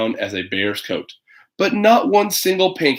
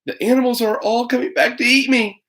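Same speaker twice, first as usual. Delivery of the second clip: put-on scared girl; 10 semitones higher than usual. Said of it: "thought Toby".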